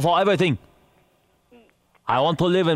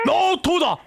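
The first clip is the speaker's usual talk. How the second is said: high-pitched mock-accented objection; insistent refusal; 13 semitones higher than usual. Prank caller repeats his refusal.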